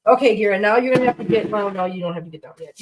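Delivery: ordinary talk, nothing marked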